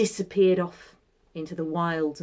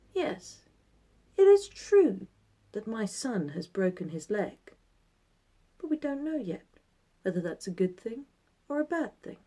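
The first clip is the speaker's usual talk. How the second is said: put-on calm, measured old man voice; wise old sage; 3 semitones higher, 5 dB quieter than usual. Old man repeats his saying.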